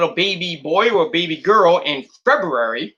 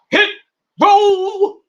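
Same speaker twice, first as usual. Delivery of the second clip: excited; 5 dB louder than usual. Real, not acted. Hyping Hit Row name.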